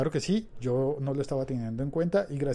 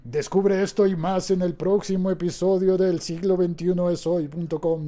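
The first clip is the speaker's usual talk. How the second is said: announcer voice; 4 semitones higher, 6 dB louder than usual. Acted. Announcer teaser for next episode.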